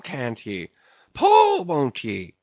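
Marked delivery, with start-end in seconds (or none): 1.1-1.6 very high, loud yelp of command; exasperated cry. A narrator's reading. Officer goads his sleepy rowers again, crying out louder. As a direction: throw the order out at full pitch.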